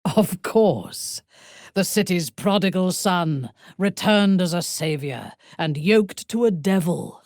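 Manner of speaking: normal talking voice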